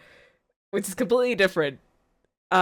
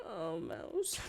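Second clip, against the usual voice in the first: high breathy whisper of sympathy; whispered pity; 10 semitones higher, 15 dB quieter than usual. Real, not acted. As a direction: sigh her name under your breath. Pitying the streamer.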